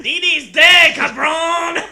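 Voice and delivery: shouted cartoon Mexican accent; mock Mexican accent. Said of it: "like a fucking Mexican stereotype".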